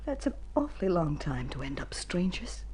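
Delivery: whisper. Acted. Wife's sad confession.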